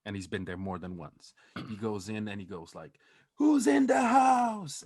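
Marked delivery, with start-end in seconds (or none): 3.4-4.8 long, high, drawn-out yell; hollered entrance call. Retold or imitated. Friend arriving at the illegal casino, imitated hollering. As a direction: announce yourself to the whole room at full voice.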